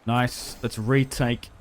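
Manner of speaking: ordinary talk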